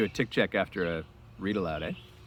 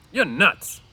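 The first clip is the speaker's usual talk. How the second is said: scoffing Crooks; 8 semitones higher, 8 dB louder than usual. A narrator's reading.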